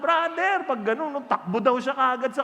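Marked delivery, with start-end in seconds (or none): 0.0-0.7 loud, high call of recognition; shout. Retold or imitated. Passenger on the bus shouting to the preacher. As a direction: cry out to him from the bus. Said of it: "Nakaganon siya".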